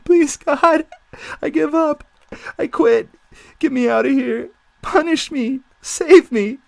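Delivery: high, loud frantic pleading; desperate begging. Acted. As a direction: beg God for rescue. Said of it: "He hears himself beg".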